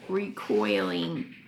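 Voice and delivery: ordinary delivery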